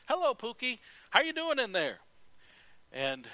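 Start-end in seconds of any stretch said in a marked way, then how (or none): 0.1-2.0 put-on high, louder playful baby-talk greeting; aunt cooing at belly. Aunt patting pregnant sister's stomach, playful high voice. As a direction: call into the belly like a doting aunt. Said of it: "she'd pat the stomach".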